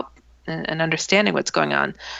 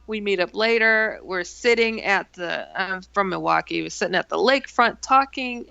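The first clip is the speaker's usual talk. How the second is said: ordinary delivery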